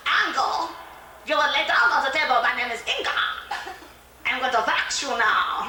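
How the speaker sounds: put-on stern, heavily accented waxing woman; commanding foreign beautician, slowing into the final announcement; Inga. Hulking waxer orders customer onto the table, mock-stern accented voice. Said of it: "She looks like a fullback or something".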